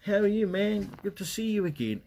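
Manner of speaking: high, bright imitation of a stranger hailing you; friendly stranger greeting. Stranger on the street greeting a forgetful young man, high cheerful imitation. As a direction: play the overly familiar guy on the street. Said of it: "they go".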